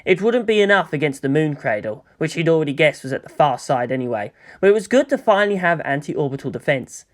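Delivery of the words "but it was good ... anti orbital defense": ordinary delivery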